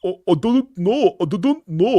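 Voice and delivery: mocking a dopey high voice; high, dumb mock reply; clueless staffer. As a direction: make the guy sound like a moron. Staff member answers clueless.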